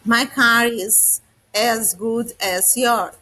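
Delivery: very slow, deliberate English for learners; teacher dictating a model sentence very slowly; very slow example sentence. Shown as a demonstration. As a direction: drag each word so students can follow.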